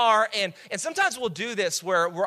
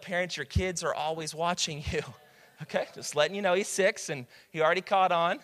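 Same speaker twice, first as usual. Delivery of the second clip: usual speaking voice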